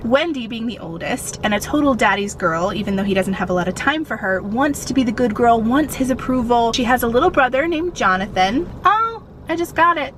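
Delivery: ordinary delivery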